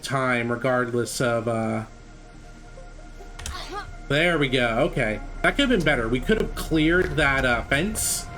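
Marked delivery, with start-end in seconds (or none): none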